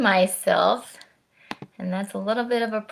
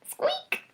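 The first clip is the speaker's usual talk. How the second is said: very high squeaky mouse noise; quick playful high mouse squeak; 8 dB quieter than usual. Pretend. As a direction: squeak like the little mouse on the page. Mouse in the book.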